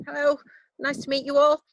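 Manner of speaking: normal talking voice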